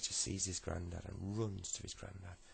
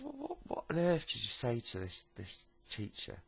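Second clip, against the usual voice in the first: higher, louder, stumbling question; stammering disbelief; 5 semitones higher, 5 dB louder than usual. Acted. Mum quizzes son about the teacher, stammering disbelief. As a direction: stammer it out in disbelief, slowing at the end.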